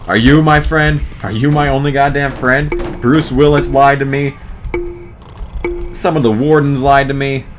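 normal talking voice

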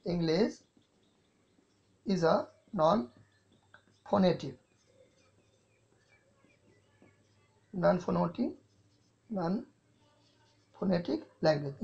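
drawn out word by word for note-taking; very slow dictation. Shown as a demonstration. Teacher dictating slowly to the class, very drawn out. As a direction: spell out each word slowly so students can write. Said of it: "what is English?".

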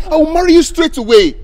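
high, loud insistence; emphatic promise. Acted. Man promising marriage to his pregnant girlfriend, loud and insistent. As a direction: press the promise urgently.

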